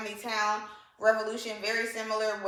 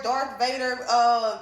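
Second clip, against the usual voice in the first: ordinary talk, nothing marked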